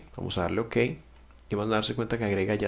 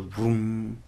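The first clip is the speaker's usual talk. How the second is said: long humming "brum" of a chord; imitated piano chord. Shown as a demonstration. Showing a full piano chord, imitated ringing "brum". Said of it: "el acorde completo".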